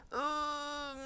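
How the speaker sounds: high, strained ugh into a laugh; mock anxious groan. Voicing the silent stomach anxiety as a high groan. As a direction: groan like a numb stomach ache. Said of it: "You're just like".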